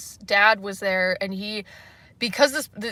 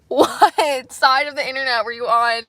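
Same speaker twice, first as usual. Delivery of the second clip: louder, laughing through the words; laughing shocked question; laughing disbelief; 4 semitones higher, 8 dB louder than usual. Real, not acted. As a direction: crack up while asking.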